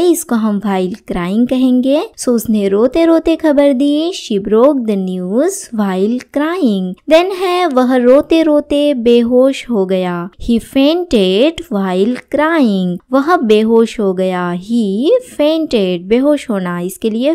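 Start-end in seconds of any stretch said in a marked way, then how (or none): 4.2-6.9 very slow, every word laid out clearly; slow model sentence. Shown as a demonstration. Teacher reading the example sentence to students, very slow. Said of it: "teacher models English sentence". 10.4-13.0 very slow, every word laid out clearly; slow model sentence. Shown as a demonstration. Teacher reading the example sentence to students, very slow. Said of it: "teacher models English sentence".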